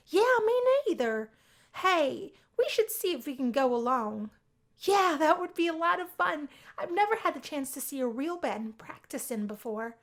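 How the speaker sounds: high, loud and eager; bubbling over at the idea of tagging along; eager Sally. Acted. Sally eagerly pitching the band trip to Anne, high and loud.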